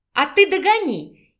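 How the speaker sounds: high, loud playful call; playful shout. A narrator's reading. Wife dares her husband to chase her, playful shout. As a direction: call out teasingly as she runs off. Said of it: "игриво крикнула девушка".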